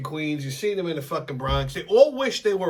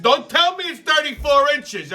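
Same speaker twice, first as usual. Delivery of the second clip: mock angry warning; 11 semitones higher, 4 dB louder than usual. Speaker threatening the installer.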